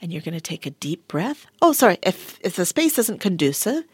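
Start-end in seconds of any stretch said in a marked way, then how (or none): none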